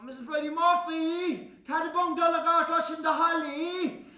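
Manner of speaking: high, loud pleading; desperate plea. Acted. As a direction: plead through the window.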